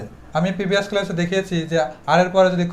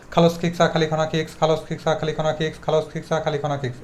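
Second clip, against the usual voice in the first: rapid, even run, sinking softer; quick monotone drill trailing down in level; one-breath tongue twister; 2 semitones lower, 5 dB quieter, faster than usual. Shown as a demonstration. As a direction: rattle it off without stopping for air. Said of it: "একদমে দশ বার বলবো".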